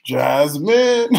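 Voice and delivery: mock-exasperated cry of her name; exasperated call. Teasing a viewer, mock-exasperated cry of her name. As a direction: call her name out like she just got you. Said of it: "and I'll be like".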